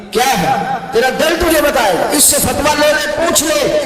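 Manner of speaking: high, rising preacher's cry; preaching at full force. Acted. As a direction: drive it home to the crowd, building to a shout. Preacher presses the crowd.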